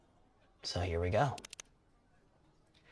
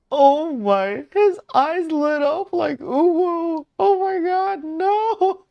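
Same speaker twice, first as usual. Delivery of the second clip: loud, very high gushing; overwhelmed squeal; 11 dB louder, slower than usual. Real, not acted. Streamer seeing his eyes light up, loud high squeal. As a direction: squeal it out at the top of your range.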